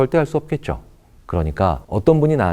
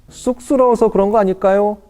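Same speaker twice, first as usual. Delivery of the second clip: imitating a shy excuse; 10 semitones higher than usual. Imagined listener's excuse.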